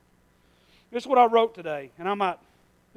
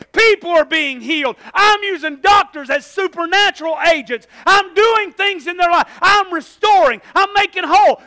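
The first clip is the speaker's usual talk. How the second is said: much higher and louder, shouted proclamation; God's voice proclaiming; 8 semitones higher, 12 dB louder than usual. Acted. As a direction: thunder it out as God declaring victory. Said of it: "And God says".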